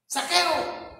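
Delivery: calling out. Retold or imitated. Preacher calls out to man.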